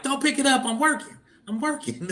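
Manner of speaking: high-pitched mock plea; pleading yell, retold. User begging housemates off the shared line, pleading. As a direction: call out high, without much volume.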